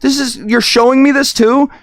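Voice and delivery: high, breathless wonder; excited. Retold or imitated. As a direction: gush like it's a miracle. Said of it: "I'm so excited".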